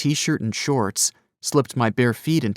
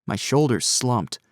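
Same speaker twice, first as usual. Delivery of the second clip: ordinary delivery, nothing marked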